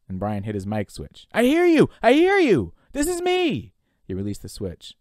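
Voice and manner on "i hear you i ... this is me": loud, very high, desperate cry; frantic, high-pitched answer; urgent shouted reply. Acted. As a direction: shout eagerly into the mic to be heard.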